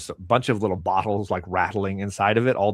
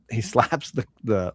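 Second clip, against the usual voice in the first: ordinary delivery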